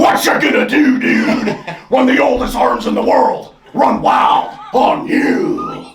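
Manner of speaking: wrestler promo voice. Retold or imitated. Hulk Hogan style promo.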